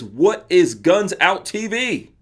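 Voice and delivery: ordinary talk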